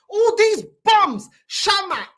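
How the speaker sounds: fed-up yell. Real, not acted. Fan fed up with his club's flops, slow loud rant. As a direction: drag it out in disgust. Ranting about club's players.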